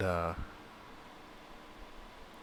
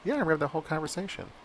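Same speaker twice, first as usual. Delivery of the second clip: brisk confirmation; 8 semitones higher, faster than usual. Retold or imitated. Office manager voiced high, fast.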